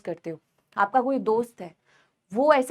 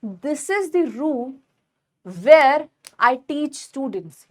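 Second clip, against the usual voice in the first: slow dictation; much slower than usual. Shown as a demonstration. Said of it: "या मैंने बोला कि".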